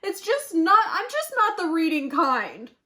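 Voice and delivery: wolf upset. A narrator's reading. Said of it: "cried the wolf".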